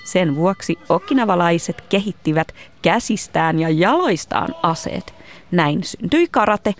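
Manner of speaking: clear, projected presenter read; scripted explainer narration. A narrator's reading. Host explains karate history to listeners, projected narration. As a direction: narrate it like a children's documentary.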